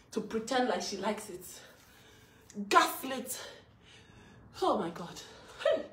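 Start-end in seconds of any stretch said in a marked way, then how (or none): none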